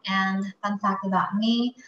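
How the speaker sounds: ordinary delivery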